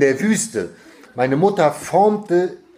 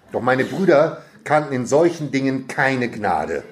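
usual speaking voice

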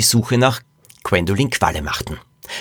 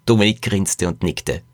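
ordinary delivery, nothing marked

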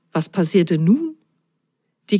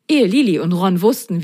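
ordinary delivery, nothing marked

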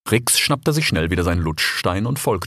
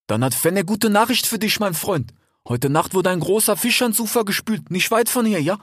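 put-on a slightly higher, friendly young fox; lightly voiced fox; 12 semitones higher than usual. A narrator's reading. Desert fox talking to his brother Rix, lightly voiced. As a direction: play the eager desert fox. Fennec voice.

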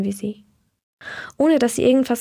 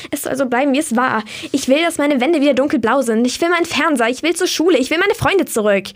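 angry outburst; 4 semitones higher, 4 dB louder than usual. Acted. Katharina's outburst about her room.

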